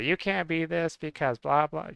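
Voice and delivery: high, sneering voice of a naysayer; mock the critic who says he can't be this. Mocking critics who yell at him.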